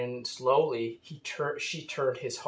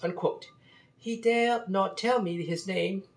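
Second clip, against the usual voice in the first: put-on French accent; 6 semitones higher than usual. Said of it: "with a French accent".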